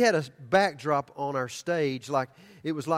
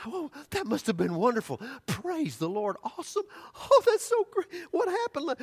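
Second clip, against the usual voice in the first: much higher, gushing, almost tearful; excited lady; 11 semitones higher than usual. Retold or imitated. Lady praising the service to the preacher, gushing much higher. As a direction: overflow with delight, voice cracking. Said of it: "she was so excited".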